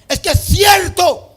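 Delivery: high, slow, shouted. Real, not acted. Preacher insisting to the church, full shout. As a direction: bellow it at the congregation.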